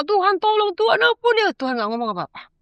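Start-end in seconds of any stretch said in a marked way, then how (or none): 0.0-1.5 high, pleading cry for mercy; retold crying scream. A woman recalling how she screamed to God for mercy, high pleading cry. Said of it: "Wah, teriak".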